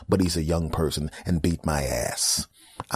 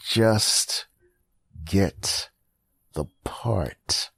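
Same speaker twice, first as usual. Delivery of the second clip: spaced out; much slower than usual. Retold or imitated. Slow spaced exasperation.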